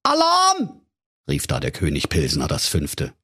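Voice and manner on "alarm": alarm cry. Acted. King raises the alarm.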